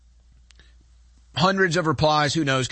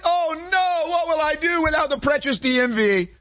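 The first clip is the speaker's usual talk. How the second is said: mock fear; 10 semitones higher than usual. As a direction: wail in fake terror. Mock panic over DMV.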